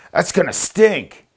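hammed-up Roman guard, slow and high; Roman soldier line. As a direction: declaim it like a skit soldier, drawn out. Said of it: "like in a Roman soldier".

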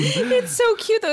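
squealing high, slow delight; gushing. Real, not acted. Host gushing about the girl's robe, squealing high. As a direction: coo over it like a cute pet. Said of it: "gushing over the robe".

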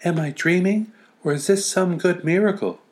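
put-on high, light little girl voice; wide-eyed little girl wondering aloud; girl's voice. Girl's inner voice in story.

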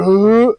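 a sudden loud mock dry heave; loud imitated gag; retching. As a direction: imitate her gagging into the sink.

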